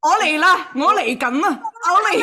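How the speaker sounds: loud, high hammed-up moan; mock climax. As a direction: overact the climax loudly.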